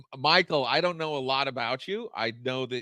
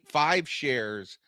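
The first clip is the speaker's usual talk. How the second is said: normal talking voice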